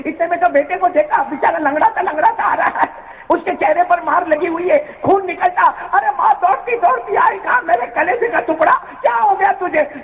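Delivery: high, emotional storytelling climax; preacher telling the climax, high and emotional; impassioned preaching. Acted. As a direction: build the mother's anguish, pitched high.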